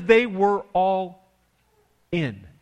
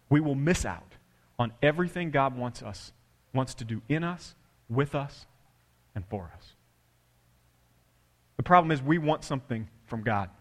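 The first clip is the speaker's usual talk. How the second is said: ordinary delivery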